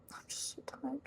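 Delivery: exhausted whispered aside; tired whisper. Real, not acted. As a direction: breathe it out, worn out. Tired aside after pep talk.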